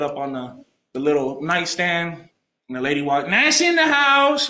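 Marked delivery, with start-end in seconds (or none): 3.3-4.5 high, drawn-out, loud imitated holler; announcing herself loudly. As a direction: mimic a woman making a grand entrance. Imitates lady's loud entrance.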